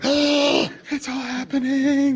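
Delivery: very high, slow, gasped wonder; breathless awe. Retold or imitated. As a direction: whisper it out in stunned delight.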